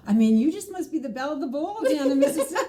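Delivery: put-on high, fast New York accent; a quick New York accent, laughing. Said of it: "she had a real heavy New York accent".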